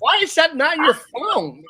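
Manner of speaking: high, drawn-out mock exasperation; incredulous question. A friend ribbing Tony about his phone, exasperated. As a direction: stretch it out high, as if baffled. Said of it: "I'm like".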